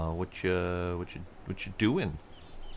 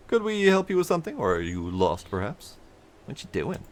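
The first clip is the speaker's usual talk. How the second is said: a hint of a welcoming foreman; friendly supervisor; 6 semitones higher than usual. Player voicing a supervisor greeting a traveler, hearty.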